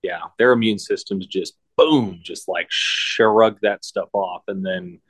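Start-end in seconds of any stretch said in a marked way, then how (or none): none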